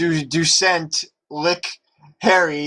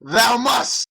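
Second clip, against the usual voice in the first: villain yell; 9 semitones higher than usual. A narrator's reading. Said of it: "he yelled".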